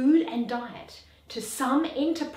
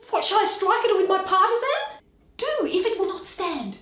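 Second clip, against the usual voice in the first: higher, louder and fast; agitated shout; 9 semitones higher, 5 dB louder, faster than usual. Acted. Marcellus asks whether to strike the ghost, agitated shout. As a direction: blurt it out in alarm.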